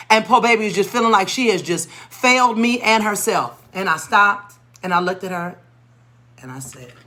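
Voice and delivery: ordinary delivery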